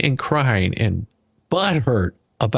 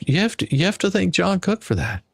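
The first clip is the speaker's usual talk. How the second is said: normal talking voice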